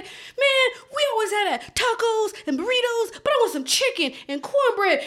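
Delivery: very high mock-whiny kid; complaining little boy. Retelling a boy tired of mom's tacos, mock complaining. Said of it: "He was like".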